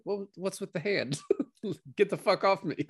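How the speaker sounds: mock offended teller. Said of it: "She's like".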